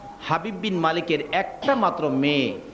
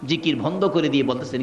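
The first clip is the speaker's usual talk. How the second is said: ordinary delivery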